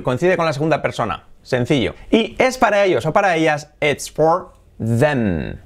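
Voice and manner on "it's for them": very slow, each word drawn out for learners; slow demo. Shown as a demonstration. Teacher modelling the pronunciation for students, very slow. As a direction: spell it out slowly with your voice. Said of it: "Them, T H E M".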